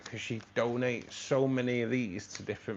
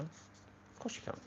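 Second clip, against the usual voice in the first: whisper; 6 semitones higher, 14 dB quieter than usual. Real, not acted. Whispered aside to himself.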